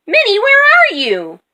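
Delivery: high, loud searching call. A narrator's reading. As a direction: call for a missing friend. Moo calls out for missing Minnie.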